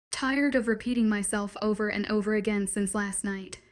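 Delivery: ordinary delivery, nothing marked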